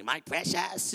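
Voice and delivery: lightly voiced creature croon over the money; a hint of the greedy ring-keeper; Smeagol again. Pastor imitating rich people clutching money, light Gollum voice. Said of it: "it's like Schmiegel with the ring".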